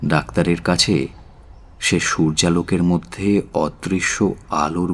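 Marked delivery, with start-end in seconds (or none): none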